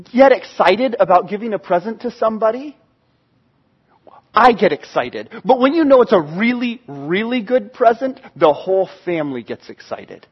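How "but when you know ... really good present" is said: ordinary talk, nothing marked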